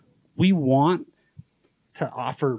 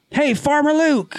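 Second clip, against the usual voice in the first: loud call; 12 semitones higher than usual. Retold or imitated. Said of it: "She was really loud".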